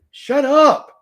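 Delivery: yelling at a heckler in chat, a loud shout; sudden yell. Real, not acted.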